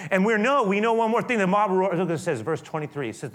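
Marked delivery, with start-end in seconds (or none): none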